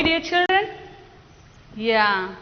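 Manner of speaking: usual speaking voice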